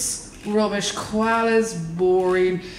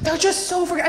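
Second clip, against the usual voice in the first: normal talking voice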